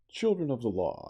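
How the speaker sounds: proclaiming loud and high. Acted. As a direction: call out like a prophet. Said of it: "lifted up my voice".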